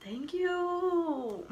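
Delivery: high, drawn-out, dainty thank you; prissy thanks. Retold or imitated. To cellmates calling her pretty, a high, prim mock-gracious thank you. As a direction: accept the compliment like a princess.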